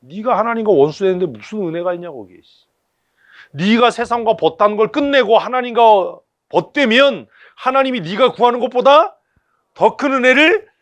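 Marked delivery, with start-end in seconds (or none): 3.5-10.7 higher and climbing toward the shout; building fervour to the amen; preacher's crescendo; rising preaching climax. Real, not acted.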